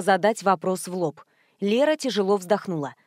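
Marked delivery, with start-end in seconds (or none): none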